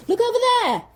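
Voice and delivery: high, urgent cry pointing off; shrill alarmed shout; panicked shout. Retold or imitated. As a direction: yell it like you've spotted danger. Rand's panicked shout, retold.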